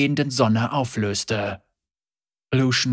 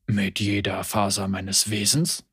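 low, soft skeptical echo; amused Lucian; 5 semitones lower, 5 dB quieter than usual. A narrator's reading. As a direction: repeat her words with a raised eyebrow.

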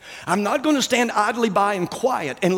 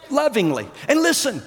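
ordinary talk, nothing marked

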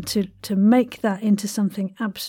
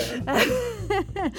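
laughing innit; 10 semitones higher, 5 dB quieter than usual. Acted. Playful slang tag with laugh.

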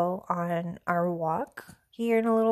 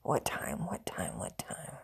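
trailing off into a whisper; fading whisper; 4 semitones lower, 11 dB quieter, slower than usual. Real, not acted. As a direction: mutter it softer each time until it vanishes. Thinking aloud, fading out.

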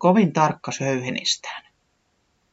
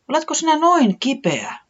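high, anxious question; worried; 13 semitones higher than usual. A narrator's reading. Taputi finding Tiputi sick, asking high and worried. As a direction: ask with worried concern. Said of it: "se huolestui".

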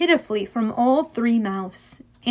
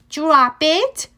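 firm, louder command, pitched up; ordered; 4 semitones higher, 5 dB louder than usual. A narrator's reading. Annabeth commands Cerberus to release ball, ordering. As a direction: order a dog to let go. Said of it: "Annabeth ordered".

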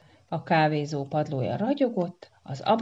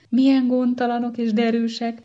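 ordinary delivery

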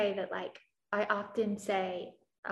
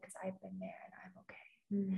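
soft whisper, holding back tears; tearful therapist's whispered reassurance; 12 dB quieter than usual. Retold or imitated. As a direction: breathe it out like a secret comfort. Therapist crying, retold tender reassurance.

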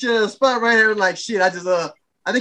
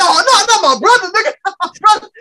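mock panic; 8 semitones higher, 6 dB louder than usual. Blake voiced in panic.

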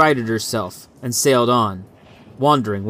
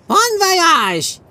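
much higher, jaunty call to the ship; cheerful farewell. A narrator's reading. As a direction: wave the ship off merrily. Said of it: "He smiled at Ochoa".

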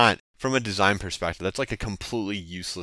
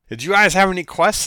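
high, loud cartoon hero; put-on voice of a keen quest seeker; eager adventurer; 11 semitones higher, 8 dB louder than usual. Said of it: "and he's like".

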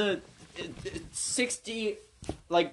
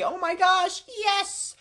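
gushing, very high-pitched squeal of delight; excited high imitation; James Charles; 12 semitones higher, slower than usual. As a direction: put on a high, thrilled impression. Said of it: "he's gonna say".

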